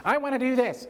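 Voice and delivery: put-on high-pitched demanding little kid; mock child's yell. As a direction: push it up high like a kid insisting. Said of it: "And they yell, raise their voice".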